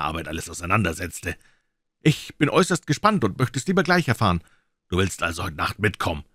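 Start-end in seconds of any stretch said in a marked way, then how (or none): 2.0-4.4 eager. Acted. Watson eager for the adventure.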